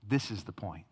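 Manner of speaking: ordinary delivery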